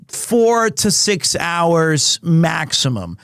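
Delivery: ordinary talk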